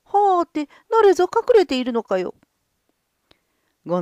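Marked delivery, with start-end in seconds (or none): 0.0-2.3 surprised. Acted. Gonzo surprised by singing.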